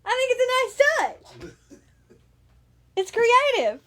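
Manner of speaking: put-on high giggly baby-talk voice; cutesy cooing. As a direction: gush like over a puppy, laughing. Keeps gushing in cutesy voice.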